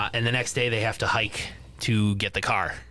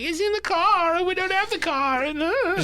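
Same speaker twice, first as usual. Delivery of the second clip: flustered. Retold or imitated. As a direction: imitate her in a high, panicky whine. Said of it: "She's starting to get really flustered".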